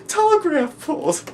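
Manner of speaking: loud, very high, laughing outburst; hysterical shriek. Acted. Joker mocking the cause of World War, hysterical. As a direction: shriek it through mad laughter.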